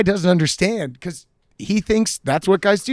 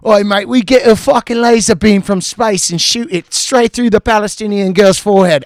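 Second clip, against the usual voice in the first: louder, higher mock British mate voice; British war-crime brag; 5 semitones higher, 6 dB louder than usual. [name] mocking the stranger in his own British accent. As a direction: ham up a laddish British boast. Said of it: "he's trying to just do his voice".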